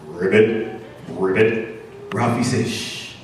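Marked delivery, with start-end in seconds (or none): 0.1-1.8 low froggy ribbit; frog croak. Acted. Unseen caged frog heard by the escapees, low croak. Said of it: "they hear another voice". 2.7-3.2 quiet hushing sound; soft shush. Acted. Mouse hushing his friend in hiding, soft shush.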